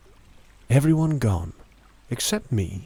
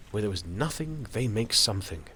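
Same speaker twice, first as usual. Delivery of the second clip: ordinary delivery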